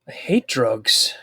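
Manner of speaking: slow breathy whisper. Acted. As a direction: hush it like a secret. Mock solemn aside.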